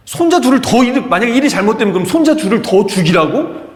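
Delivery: high, loud, indignant questioning; incredulous outrage. Acted. Preacher arguing for bereaved Jacob against Reuben's offer, incredulous outrage. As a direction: throw the offer back in disbelief.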